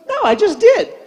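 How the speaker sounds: lightly voiced little girl, pitched high; high little-kid imitation; granddaughter's voice. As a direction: imitate a small child answering Grandpa, high. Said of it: "And she goes".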